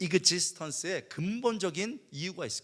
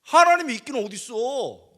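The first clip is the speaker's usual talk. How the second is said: furious challenge to God, yelled; angry teen yelling 하나님이 있기는 어딨어; angry shout; 8 semitones higher, 9 dB louder than usual. Retold or imitated. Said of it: "내가 화가 너무 나가지고".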